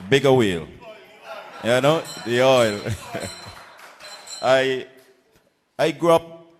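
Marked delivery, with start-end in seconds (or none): 0.1-2.8 loud, higher excited call to the crowd; excited shout. Real, not acted. Candidate boasts whaling roots to rally crowd, excited shout. 2.9-4.4 laugh. Real, not acted. Laughs after whaling joke.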